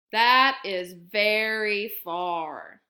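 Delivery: loud and slow, savouring it; big hare marvelling at the distance. Acted. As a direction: stretch it out, fondly. Big hare slowly agrees.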